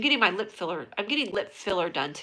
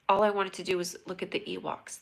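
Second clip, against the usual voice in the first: ordinary delivery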